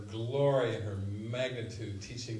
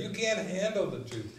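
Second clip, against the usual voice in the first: Nicholson quote again; 7 semitones higher than usual. Retold or imitated. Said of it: "Nicholson would say".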